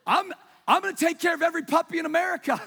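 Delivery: laughing, hammed-up overwrought promise; mock tearful pledge. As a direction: rush it, laughing at your own gush. Mock tearful reaction to dog ad.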